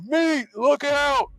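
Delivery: high, drawn-out loud yell; yelled warning. Acted. Character yells to Meat from the slide, yelled warning. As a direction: holler a stretched-out warning way up high. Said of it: "And I yell".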